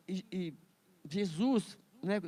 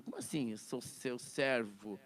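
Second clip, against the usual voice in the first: humiliated servant; 8 semitones lower, 8 dB quieter than usual. Retold or imitated. Servo humilhado reclama.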